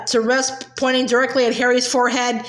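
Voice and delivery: ordinary delivery, nothing marked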